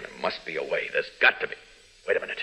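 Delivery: hushed, low musing under the breath; quiet desperate thought. Acted. Superman alone with no time left, hushed. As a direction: think it through to yourself. Hero desperate, thinking aloud.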